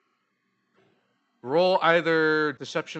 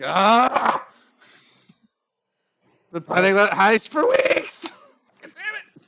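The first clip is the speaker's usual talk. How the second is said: loud, slow cry of dismay; frustrated elf; 6 semitones higher, 6 dB louder, slower than usual. Acted. Elf woman cursing the heist that went down the chimney. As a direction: rage over the lost loot. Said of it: "She's like".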